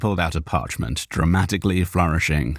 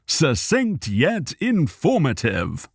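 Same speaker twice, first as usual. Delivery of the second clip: slow appreciative gentleman; 9 semitones higher, slower than usual. Acted. Cal voiced.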